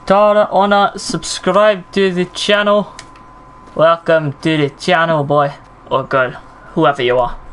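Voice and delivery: a light, bad Scottish accent on a channel shout-out; terrible Scottish accent. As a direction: greet the viewers in a clumsy put-on Scottish accent. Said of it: "doing a terrible Scottish accent".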